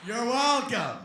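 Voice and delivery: very loud, high character shout; booming catchphrase. Acted. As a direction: belt it out as a comic callback. Callback to his Hondo catchphrase.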